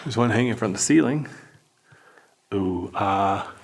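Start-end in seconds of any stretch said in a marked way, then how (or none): 2.5-3.5 canned crowd awe; sitcom audience ooh-ah. Acted. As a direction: coo like a sitcom studio audience. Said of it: "They got the laugh track added".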